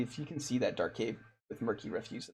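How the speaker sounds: ordinary talk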